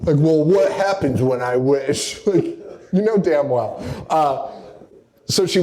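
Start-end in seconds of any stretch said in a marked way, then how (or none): 0.2-2.2 mock naive question. Mocking Alex's naive question.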